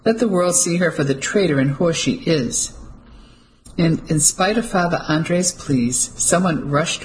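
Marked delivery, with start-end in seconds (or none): none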